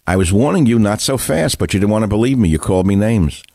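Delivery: ordinary talk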